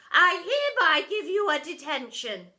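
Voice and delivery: high and loud deputy; bossy deputy arguing with herself. A narrator's reading. Deputy arguing with herself, loud and high.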